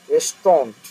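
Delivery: "রেস্টুরেন্ট" said as two-beat "res-tront"; correct pronunciation. Shown as a demonstration. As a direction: say "RES-tront", middle syllable swallowed. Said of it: "এরকম উচ্চারণটা হবে".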